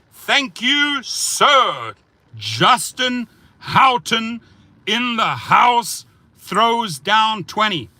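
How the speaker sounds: high, slow, shouted shout-out; hype announcer shout. Acted. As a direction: boom it out like a ring announcer. Hyped repeat of donor shout-out.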